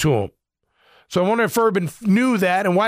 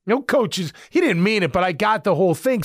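ordinary talk, nothing marked

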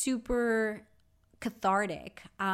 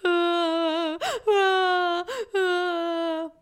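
gasping, wailing sobs; loud demonstration of sobbing; demo sob; 10 semitones higher, 7 dB louder than usual. Shown as a demonstration. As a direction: sob loudly, catching your breath. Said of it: "this is what sobbing sounds like".

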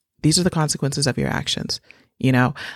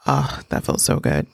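breathy, relieved, much lower, slow; satisfied sigh; 11 semitones lower than usual. Real, not acted. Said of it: "that felt so just like".

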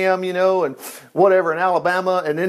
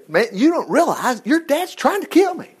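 high-pitched, swooping protest; acted disbelief at the danger; David's exasperated plea; 7 semitones higher than usual. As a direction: plead urgently, pitch jumping up. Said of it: "this is David saying".

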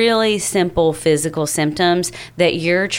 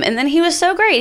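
ordinary talk, nothing marked

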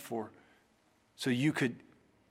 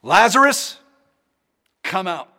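loud call; 5 semitones higher, 12 dB louder than usual. Acted. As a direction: call the name out loud and high, much louder. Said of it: "called in a loud voice".

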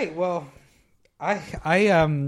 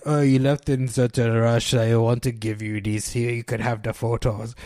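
badly faked Eastern European accent, sinking lower; 3 semitones lower than usual. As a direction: clumsily imitate an Eastern European man. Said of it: "You sound like Gooey Ball".

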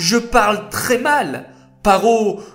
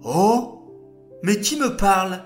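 higher startled question; puzzled wonder; 5 semitones higher than usual. Acted. Moshe hears a voice from the bush, higher and puzzled. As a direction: wonder who is speaking. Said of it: "s'interrogea Moshe".

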